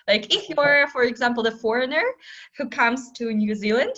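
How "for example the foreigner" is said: ordinary talk, nothing marked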